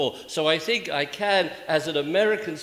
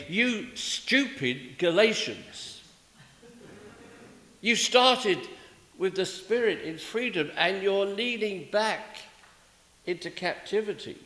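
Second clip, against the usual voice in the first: Paul scolding; 7 semitones higher than usual. Acted. Paul rebuking the Galatians, mock indignant scold. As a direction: thunder at them like an exasperated apostle. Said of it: "He begins chapter three of Galatians by saying".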